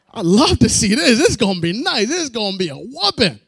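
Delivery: loud, gleeful relish; loud gleeful anticipation; gleeful excitement. Retold or imitated. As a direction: whoop it up over the coming fight. Gleeful at a coming fight.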